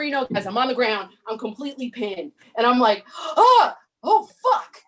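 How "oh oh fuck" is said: higher, louder startled yelp; startled panic. Retold or imitated. Pinned by her boyfriend, she yelps in startled panic. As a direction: caught off guard and pinned.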